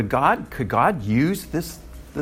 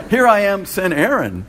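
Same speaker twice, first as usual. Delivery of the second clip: louder, laughing mock volunteer; 6 semitones higher, 5 dB louder than usual. Preacher joking about passing the job on, laughing and louder. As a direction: deliver the joke through a laugh.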